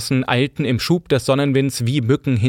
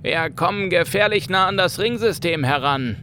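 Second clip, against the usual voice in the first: worried crewman; 6 semitones higher than usual. Acted. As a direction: voice a wary sailor. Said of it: "gab einer der Männer zu bedenken".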